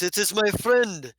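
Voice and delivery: loud boisterous yes; hearty gladiator. Acted. Gladiator Hafis greeting his friend, loud and hearty. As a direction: boom it out like a showman. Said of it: "he goes".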